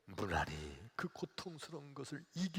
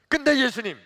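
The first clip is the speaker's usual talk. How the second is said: loud, high challenge; confronting; 11 semitones higher, 17 dB louder than usual. Acted. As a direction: turn on Jesus with a sharp objection. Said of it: "따지듯이 예수님께 대들었어요". (따지듯 크고 높게)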